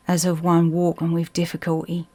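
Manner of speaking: plain reading voice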